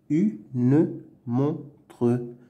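"Une montre" as "u", "ne", "mon", "tre"; syllable by syllable. Shown as a demonstration. Teacher sounding out words to read, "Une montre" in separate syllables. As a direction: sound the final e's in a split "Une montre". Reading drill, split then blended.

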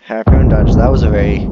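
sudden loud outburst; loud exclamation. Real, not acted. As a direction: blurt it out loud. Reacting to game fail.

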